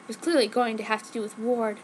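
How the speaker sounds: ordinary delivery, nothing marked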